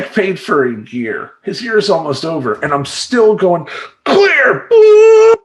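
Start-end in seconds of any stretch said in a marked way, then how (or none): 4.0-4.7 loud, high warning call; mock defibrillator shout. Coach still trying to revive a client, mock urgent shout. 4.7-5.4 very loud, high-pitched boop; imitated defibrillator noise. Coach shocking a flatlining client, loud imitated zap.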